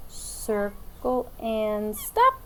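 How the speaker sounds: drawn-out, slow circle and stop; slow teacher demo. Shown as a demonstration. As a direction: stretch it out as you draw. Teacher demos letter stroke.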